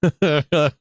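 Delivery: brief run of real laughter. Real, not acted. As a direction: chuckle to yourself. Laughs amid anger at losses.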